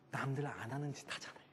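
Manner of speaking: low, hushed aside; very soft whispered remark; confiding whisper. Real, not acted. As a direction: whisper it like a secret to the audience.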